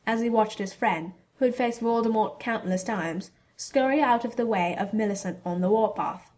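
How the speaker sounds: ordinary delivery, nothing marked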